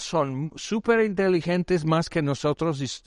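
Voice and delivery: normal talking voice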